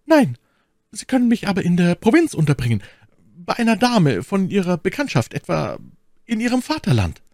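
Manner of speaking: high, pleading maid's voice; flehende Ketty. Acted. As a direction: beg him in a high, urgent voice. (flehende, hohe Mädchenstimme)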